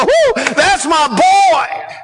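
slow, loud, high shout of delight; God cheering his son, overjoyed; excited cheer. Acted. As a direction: whoop like a proud father in the stands. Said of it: "cause him to stand up and go".

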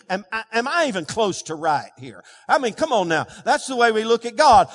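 ordinary talk

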